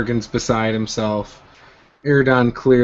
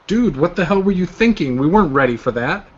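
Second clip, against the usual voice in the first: higher-pitched, fast incredulous scolding; exasperated confrontation; 6 semitones higher, faster than usual. Acted. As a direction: push back hard, like a rattled friend who almost died. Player confronts Durgan in character.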